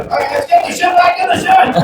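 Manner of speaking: hammed-up chore call; fed-up homeowner. Homeowner announces the spring shed clear-out. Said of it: "Two months later".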